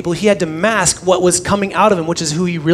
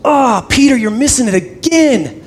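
loud, higher, hammed-up exasperation; exasperated mock God; 6 semitones higher, 4 dB louder than usual. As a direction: cry out in mock dismay at a clueless disciple. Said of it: "God does not speak like that".

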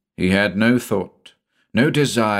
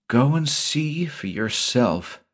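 slow, high-pitched dare; challenging; 7 semitones higher, slower than usual. A narrator's reading. Shula daring Avilton to check the library, challenging. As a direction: challenge him with drawn-out words. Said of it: "challenged Shula".

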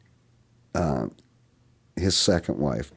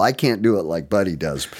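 ordinary talk

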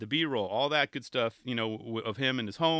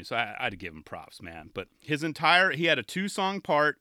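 ordinary delivery

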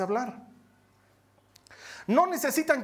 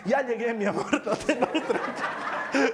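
laughing through the wife's lines; 2 semitones higher than usual. Retold or imitated. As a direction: giggle while calming him down. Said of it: "su mujer sale y le dice".

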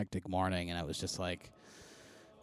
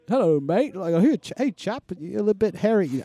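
hammed-up British accent, pitched well up; mock Brit chap voice, high and loud; playful British accent impression; imitating a British accent; 12 semitones higher, 9 dB louder than usual. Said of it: "he did, like, this accent".

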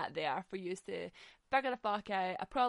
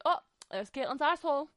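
higher, louder mocking friend; teasing [name]; 6 semitones higher, 8 dB louder than usual. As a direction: tease her gleefully, pitched up and loud. [name]'s recurring teasing joke.

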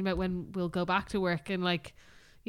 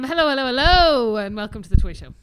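louder and higher, a hammed-up presenter welcome; mock TV host; 4 semitones higher, 10 dB louder than usual. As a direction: open the show like a loud game host.

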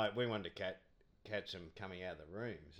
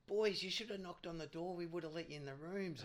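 higher, lightly mocking player voice; imitating the players; 9 semitones higher than usual. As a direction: pitch it up like a cheerful cricketer inviting fans. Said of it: "they go".

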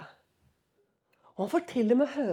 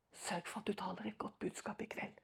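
whispered, much softer; whispering; 3 semitones lower, 15 dB quieter than usual. Retold or imitated. Leader whispering to the preacher to keep the sermon light. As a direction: lean in and whisper the request. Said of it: "hvisket inn i øret".

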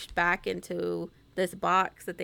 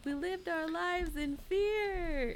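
mock dramatic dad; 9 semitones higher, 8 dB quieter, much slower than usual. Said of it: "he's like".

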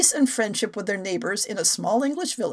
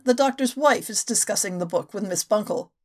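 ordinary talk

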